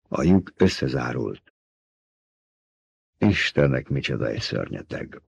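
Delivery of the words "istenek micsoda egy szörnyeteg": low, soft murmur sinking away; awed murmur. A narrator's reading. Man beside Paul reacting to the monster, low murmur. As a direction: murmur it low, almost to yourself. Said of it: "mormolta Paul mellett ülő".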